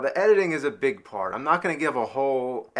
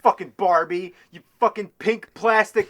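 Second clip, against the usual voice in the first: loud, high-pitched furious outburst; exasperated gamer blowing up; angry rant; 7 semitones higher, 6 dB louder than usual. Acted. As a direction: spit out the insults at a raised pitch. Recording an angry scripted rant at game.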